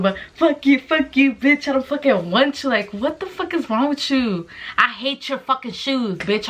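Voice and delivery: high-pitched, exasperated tirade; angry rant. Real, not acted. Telling the letter-writer she hates his shoes, angry rant. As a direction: curse him out, voice riding high. Ranting at the man stalking her.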